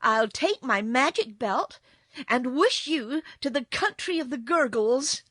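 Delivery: loud, emphatic threat; provoked girl. A narrator's reading. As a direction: threaten the kitten crossly. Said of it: "getting provoked".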